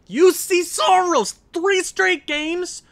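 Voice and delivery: loud, high, drawn-out disbelief; outraged shout. Real, not acted. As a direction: yell it slowly at the goalie, incredulous. Raging at his goalie.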